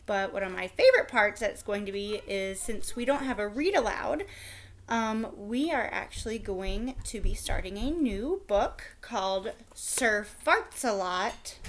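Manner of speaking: ordinary delivery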